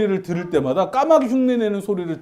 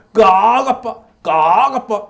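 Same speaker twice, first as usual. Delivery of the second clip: louder slow caws calling dad; father crow caw; 4 dB louder, slower than usual. Retold or imitated. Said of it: "아빠 까마귀 흉". (아빠 까마귀 소리 흉내)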